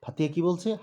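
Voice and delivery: ordinary delivery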